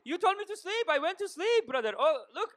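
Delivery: put-on high Persian accent, laughing. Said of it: "I do Persian one all day".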